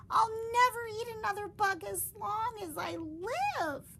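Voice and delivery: triumphant cry; high loud happy vow; cheering. Acted. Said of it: "cheered Stellaluna".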